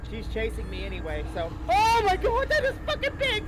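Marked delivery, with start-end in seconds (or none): 1.7-3.4 high, loud terrified shriek; panicked scream. Real, not acted. Chased by big monster.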